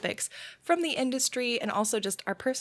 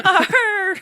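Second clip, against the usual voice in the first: high, loud pirate "Arr" with a laugh; 11 semitones higher, 11 dB louder than usual. Acted. Host echoes the pirate "Arr", high and loud. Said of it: "Not enough R's".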